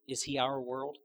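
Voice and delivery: usual speaking voice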